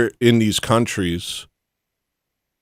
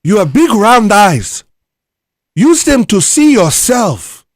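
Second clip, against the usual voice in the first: put-on very high, loud, slow African accent; 12 semitones higher, 8 dB louder, slower than usual. Urging the viewer to look at themselves, high mock African accent. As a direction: drag out each word loudly in a mock African accent. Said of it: "I would use an African accent".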